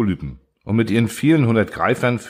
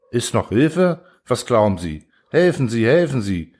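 urgent call; 4 semitones higher than usual. A narrator's reading. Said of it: "rief da das gute Seepferd".

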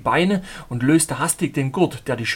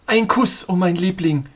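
passionate reply; 4 semitones higher, 5 dB louder than usual. Acted. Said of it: "gab ich zurück".

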